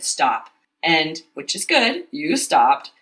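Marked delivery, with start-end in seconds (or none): 0.0-0.5 a short, firm retold stop; abrupt mock stop. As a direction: cut it off quickly, lightly imitating. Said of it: "very abruptly said".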